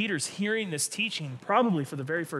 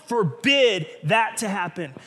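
Peter forbidding; 5 semitones higher, 6 dB louder than usual. Acted. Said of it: "he says".

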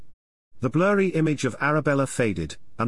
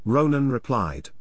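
ordinary delivery, nothing marked

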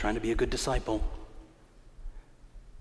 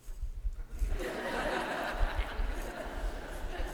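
high breathless laugh; 14 semitones higher, 6 dB quieter than usual. Real, not acted. Laughing imitating [name].

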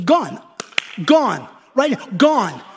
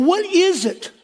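raised, high-pitched question; 8 semitones higher, slower than usual. Real, not acted. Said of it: "stop talking in that high voice".

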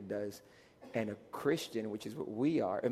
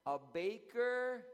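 drawn-out prompt; 9 semitones higher, 4 dB quieter, much slower than usual. Real, not acted. Cue for congregation to answer.

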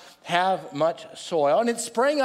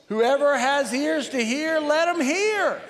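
slow and drawn out, pitch climbing high on hear; slow unison recitation; 5 semitones higher, slower than usual. Shown as a demonstration. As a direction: lead the whole room through it slowly, line by line. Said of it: "Say it out together".

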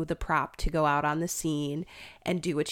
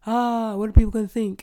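higher, louder, hammed-up fretting; mock worry; 4 semitones higher, 6 dB louder, faster than usual. Teen worrying about Bible club gossip, mock worry. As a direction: fret in pretend alarm over being judged.

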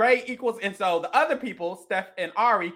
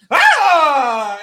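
mock scream; 5 semitones higher, 8 dB louder than usual.

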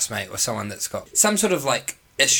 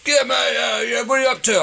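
much higher, louder blokey mate voice shouting down the phone; imitating Joshy; 12 semitones higher, 5 dB louder than usual. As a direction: do your excited mate calling you up, loud and high. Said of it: "Joshy rings me and goes".

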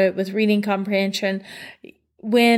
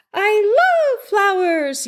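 "love" stretched long and pitched high; stress on LOVE; 10 semitones higher, much slower than usual. Shown as a demonstration. Teacher showing parents how children read with inflection, emphatic LOVE. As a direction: lean hard on "love", drawn out and high. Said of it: "they put some emphasis into the sentence".

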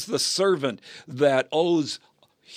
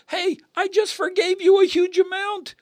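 much higher, louder outburst; indignant rebuke; 6 dB louder than usual. Acted. Master calling back the unforgiving servant, indignant shout. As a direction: cry out in outraged disbelief.

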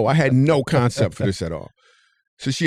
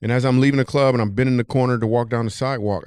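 ordinary talk

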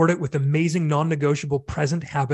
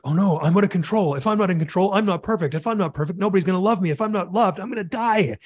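higher-pitched, spiraling mock panic; crazy inner panic; 4 semitones higher than usual. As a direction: voice anxious thoughts tumbling into catastrophe, pitched up. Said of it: "this was my crazy subconscious, uh, like, thought process".

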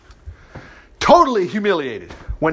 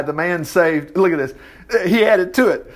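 ordinary delivery

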